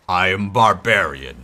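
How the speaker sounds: dumb barbarian voice. Pretend. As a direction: play a dim-witted barbarian.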